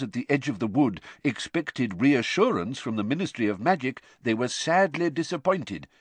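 deliberate, lilting reading of the press; article read aloud. Acted. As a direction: read out a sensational report with emphasis. Character reads newspaper article aloud.

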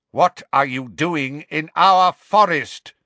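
angry centaur, loud and drawn out; bellowing. A narrator's reading. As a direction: bellow at intruders. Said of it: "bellowed the hard-faced grey centaur".

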